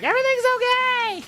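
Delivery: high shouted all-clear from afar; calling out. Acted. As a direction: yell it back across the camp. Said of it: "you hear him call back".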